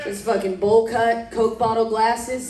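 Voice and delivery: ordinary talk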